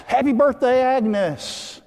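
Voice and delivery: high, loud cheer; celebratory shout. Acted. Diner crowd surprising the birthday woman, shouting. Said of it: "everybody shouts".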